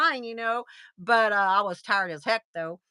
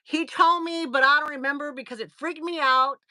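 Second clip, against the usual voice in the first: ordinary talk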